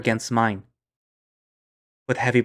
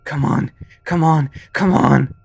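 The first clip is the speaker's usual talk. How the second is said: louder, urging yourself; frustrated strain, getting louder; straining effort; 4 semitones higher, 7 dB louder, slower than usual. Acted. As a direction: push through it out loud. Struggling to open hands.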